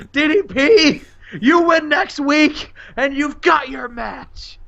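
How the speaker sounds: high, slow, strained mock shout; hammed-up pained challenge; acted furious wrestler. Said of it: "he's just like".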